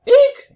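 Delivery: very high, loud robot eek; alarmed squeak. A narrator's reading. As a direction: squeal a sudden bleep of alarm. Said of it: "bleeped Hubble in alarm".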